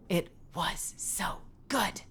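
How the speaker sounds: slow breathy whisper of so good; sultry whisper. Pretend. Telling the chat about pasta, sultry whisper. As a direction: whisper it like a sensual memory.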